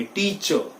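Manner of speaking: टीचर as tee-chuh, silent r; correct pronunciation. Shown as a demonstration. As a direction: drop the final r in टीचर. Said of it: "R is silent". (टीचर का सही उच्चारण, बिना र के)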